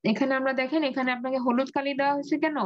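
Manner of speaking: usual speaking voice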